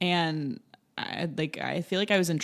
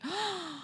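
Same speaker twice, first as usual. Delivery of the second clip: gasp; 13 semitones higher, 10 dB quieter than usual. Retold or imitated. Strangers admiring the fries.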